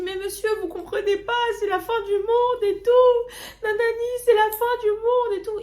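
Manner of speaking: very high, loud, slowed wail; panicked wailing. Retold or imitated. Crying to a friend's father that the world ends. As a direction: wail it in mock despair.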